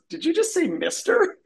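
high, squeaky disbelief; incredulous question. Acted. Little Katie questions what was just said, incredulous.

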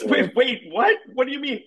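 high, flustered questions; mock panic. Little Katie told to fold his arms, panicky. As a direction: blurt it out high, in acted alarm.

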